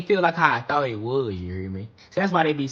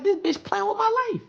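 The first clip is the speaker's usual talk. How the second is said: mock despair. Closet full, can't hide.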